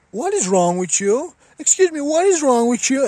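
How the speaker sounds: high, indignant shriek of disbelief; outraged mock voice. Mimicking a stranger on Twitter, outraged and high-pitched. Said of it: "and they're like".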